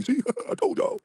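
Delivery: mimicking the rival on his live, squealing that he told everyone; mock gloating. Mocking rival's live stream.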